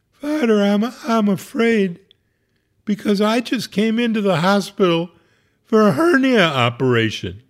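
high, frightened, mildly tearful patient; sobbing. Retold or imitated. Hernia patient confessing her fear to the priest, high and tearful. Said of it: "She sobbed".

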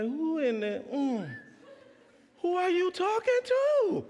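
mock-offended squeal; indignant disbelief. Indignant comeback.

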